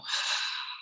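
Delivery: long unvoiced sigh out; audible sigh. Shown as a demonstration. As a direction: let the breath go with a soft sigh. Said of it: "and let the breath go".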